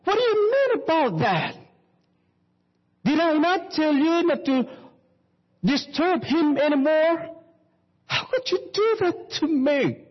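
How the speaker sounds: high, near-crying outburst of an upset father; hurt husband's tearful high protest. Acted. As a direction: plead and protest in a high cracking voice. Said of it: "And the husband saw what she was doing".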